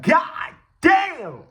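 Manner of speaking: high, loud, drawn-out exclamation; mama's retold shock. Storyteller as mama seeing the bump, mock shocked cry. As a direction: imitate mama's loud shock, stretching both words.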